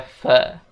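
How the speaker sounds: "fe" a short weak vowel; reduced fe. Shown as a demonstration. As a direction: say for as a quick fe. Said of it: "for itu dibaca".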